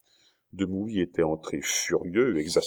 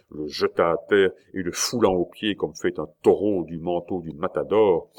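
plain reading voice

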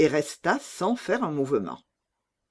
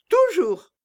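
much higher, loud and blunt; bold robber girl; 6 dB louder than usual. Acted. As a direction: toss it off like a fearless bandit girl. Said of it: "répondit la fille des voleurs".

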